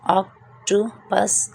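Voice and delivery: Octopus drawn out slowly; slow model. Shown as a demonstration. Teacher models octopus slowly for students. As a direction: spell out octopus at a slow pace. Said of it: "teacher models word twice".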